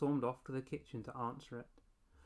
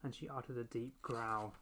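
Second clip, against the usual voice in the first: plain reading voice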